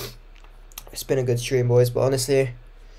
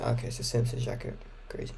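whispering; 2 semitones lower, 13 dB quieter than usual. Real, not acted. Muttered aside while watching.

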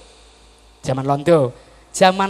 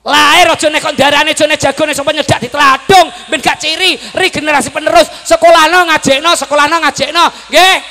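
high, loud rapid-fire exhortation; full-force preaching; 10 semitones higher, 11 dB louder than usual. Real, not acted. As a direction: shout the urging at the crowd without letting up.

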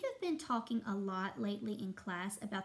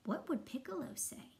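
ordinary delivery, nothing marked

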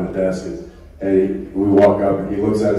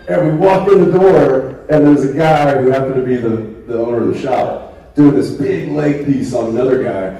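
normal talking voice

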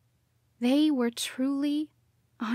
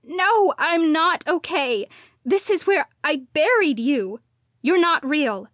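higher and louder, upset; loud distress; 4 semitones higher, 6 dB louder than usual. A narrator's reading. Daughter crying to her parents' ghosts. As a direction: cry it out in despair. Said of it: "I cried".

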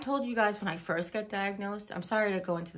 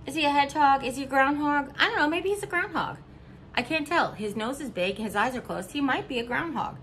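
lighter, higher voice, wondering aloud; playful high musing; 7 semitones higher, 5 dB louder than usual. Real, not acted. Viewer shown the figurine, higher playful wondering. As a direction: puzzle over the critter with delight. Puzzling over a cute figurine.

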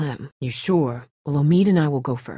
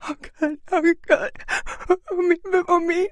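distraught calling. Acted. Calling to injured Omid.

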